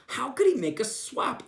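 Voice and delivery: ordinary delivery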